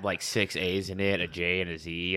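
ordinary talk

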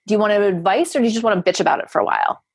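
fast, offhand version of the question; blunt offer. Shown as a demonstration. Coach showing the same question in another tone, blunt offer. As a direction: rattle it off quickly and casually. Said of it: "or you can say".

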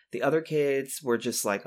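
ordinary talk, nothing marked

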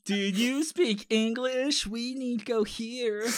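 over-enunciate like talking down; mock slow talking. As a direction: parody of slow condescending speech.

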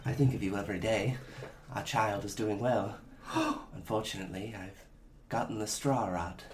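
soft letter voice. Acted. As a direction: read it like a frail loving wife. Reading letter from dying wife.

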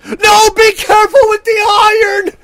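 high, loud panicked shout; shrill panic about the iron burning the house; freaking out. Retold or imitated. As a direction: scream it in total freak-out. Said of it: "total freak out mode".

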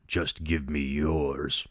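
low, slow menacing rasp; a sweet child's voice turning into a slow, low demonic rasp; raspy dark voice. A narrator's reading. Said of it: "His voice suddenly became raspier and darker".

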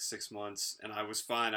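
ordinary delivery

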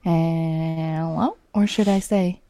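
playful put-on French accent; host the show as a mock Parisian. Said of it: "[name]'s the best at imitating accents".

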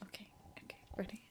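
very hushed whispered cue; conspiratorial whisper. Pretend. Co-host cueing a retake, whispered. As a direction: whisper it as an off-air aside.

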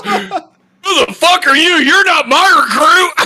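high, loud, laughing through the shout; laughing drill sergeant yell. Retold or imitated. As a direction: yell it while cracking up. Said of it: "He goes".

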